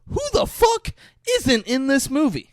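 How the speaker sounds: incredulous high shout; amazed shout. Real, not acted. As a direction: yell it at the screen in disbelief. Amazed at the star cast.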